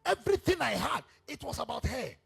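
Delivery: high, loud anguished cry; broken-down outcry. Acted. King crying out at his favourite wife's betrayal, high and loud. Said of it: "The man was broken down".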